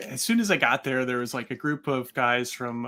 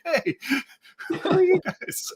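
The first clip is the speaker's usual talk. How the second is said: high, slow, laughing call of hey; laughing high greeting; 14 semitones higher, slower than usual. Retold or imitated. Circus folk calling out greeting.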